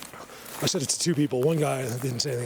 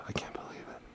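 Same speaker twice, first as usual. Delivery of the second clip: whisper; 15 dB quieter than usual. Real, not acted. Awed whisper in quiet forest.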